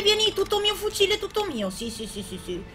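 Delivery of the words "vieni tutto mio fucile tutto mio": high gleeful cooing; giddy greedy gamer; greedy delight. Real, not acted. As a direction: claim the gun like a treasure. Finds a shotgun.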